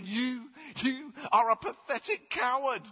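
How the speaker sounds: high, loud scolding, finger pointed; mock confrontation. The man retells scolding the biker, high and loud. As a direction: tell off the ringleader.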